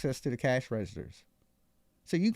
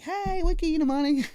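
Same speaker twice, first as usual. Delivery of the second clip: 1 hammed-up manager; 11 semitones higher, 4 dB louder than usual. Feminine manager voiced.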